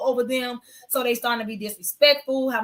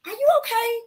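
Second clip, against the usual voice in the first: gentle concerned question; 12 semitones higher than usual. Retold or imitated. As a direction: ask "Are you okay?" sweetly and much higher. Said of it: "I was like".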